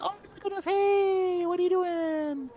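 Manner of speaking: high-pitched nervous hello to a millipede; nervous friendliness. Retold or imitated. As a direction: talk sweetly to the scary bug. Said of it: "It's gonna be like".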